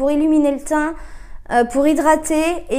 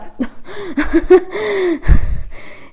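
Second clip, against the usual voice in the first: startled laugh; 5 semitones higher than usual. Real, not acted. Nearly drops the bleach bottle.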